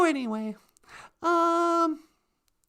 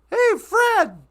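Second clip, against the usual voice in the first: loud, high mock holler; calling out; 7 semitones higher, 7 dB louder than usual. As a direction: call out to him cheekily, louder. Imagined calling out to Fred.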